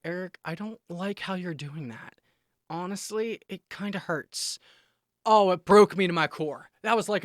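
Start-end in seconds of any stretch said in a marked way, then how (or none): 0.0-4.6 soft retelling of a hurt plea; gentle hurt plea. Retold or imitated. Retelling wife telling husband it hurts, soft and gentle. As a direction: say it softly, gently owning the hurt.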